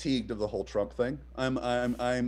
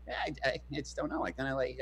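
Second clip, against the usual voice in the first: imitating Adam's guy; 8 dB quieter, faster than usual. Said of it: "You're doing an impression of him to him?".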